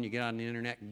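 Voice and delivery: normal talking voice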